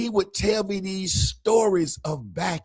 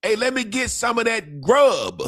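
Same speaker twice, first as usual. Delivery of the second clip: higher, shouted, "grub" said clearly; mock shout; 7 semitones higher than usual. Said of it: "but then he would say".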